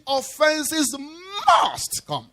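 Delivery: "must" slowed and leaned on hard; the whole line stretched out, "must" heaviest; stress on MUST. Shown as a demonstration. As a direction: weigh down on "must" slowly. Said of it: "So I'm stressing the word must".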